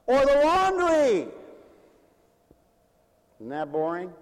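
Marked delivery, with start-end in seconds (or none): 0.0-1.3 loud, high, drawn-out exclamation; slow preacher's shout; emphatic call. Real, not acted. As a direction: throw it out high to the crowd. Preacher calls out next chore.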